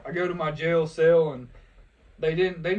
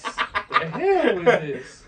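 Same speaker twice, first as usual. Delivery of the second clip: laughing protest; 6 semitones higher, 7 dB louder, much slower than usual. Retold or imitated. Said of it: "own retold protest, laughing".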